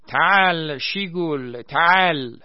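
put-on loud, high, slow roar in an Arabic accent. A narrator's reading. Vahid as Saddam summoning Shemr, loud Arabic-accented roar. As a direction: bellow it in a mock Arabic accent. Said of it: "با لهجهٔ عربی نعره زد".

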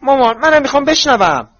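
put-on very high, loud girlish plea; protesting girl. A narrator's reading. Daughter begs her mother to let her listen, high and insistent. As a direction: pitch it right up and plead loudly.

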